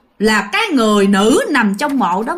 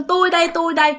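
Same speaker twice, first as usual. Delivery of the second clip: mock crying plea; 5 semitones higher, faster than usual. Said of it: "mà khóc".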